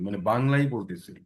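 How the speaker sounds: normal talking voice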